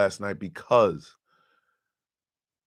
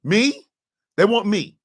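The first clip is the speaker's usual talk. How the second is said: rising, louder disbelief that they picked him; stunned disbelief; 10 semitones higher, 4 dB louder than usual. Retold or imitated. Retelling the moment he was asked to join, disbelieving. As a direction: pitch climbs with each Me, getting louder. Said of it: "I was like".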